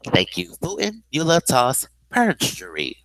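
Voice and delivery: ordinary delivery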